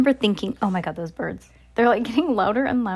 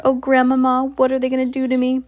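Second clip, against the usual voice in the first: raised, drawn-on plea to grandma; lightly acted frightened wail; mild acted dread; 4 semitones higher, 5 dB louder than usual. Trapped boy pleads for grandmother.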